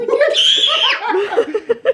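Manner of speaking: high shout into laughter; scream into giggles. Real, not acted. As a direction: yelp then giggle. Dad pretending to be scared.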